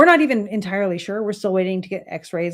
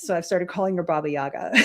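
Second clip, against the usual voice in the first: ordinary talk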